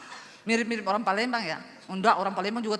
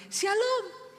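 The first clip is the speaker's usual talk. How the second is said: sweet churchy greeting; 11 semitones higher than usual. Pretend. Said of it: "Saya pikir".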